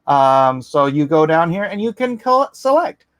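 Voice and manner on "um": ordinary talk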